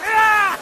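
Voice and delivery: high, short acted scream; mock attack yell. Man attacked by his crazy dog, mock yell. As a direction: yell as if something lunges at you.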